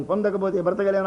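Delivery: usual speaking voice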